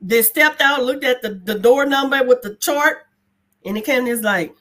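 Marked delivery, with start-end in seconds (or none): none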